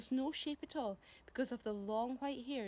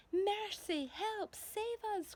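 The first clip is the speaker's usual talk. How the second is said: high, panicky ladybird cry; tiny terrified ladybird calling out; frightened cry; 10 semitones higher, much slower than usual. Acted. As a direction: squeal for help in fright. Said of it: "cried the ladybird".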